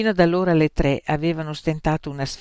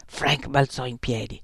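plain reading voice

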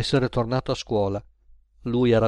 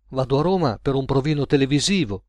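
plain reading voice

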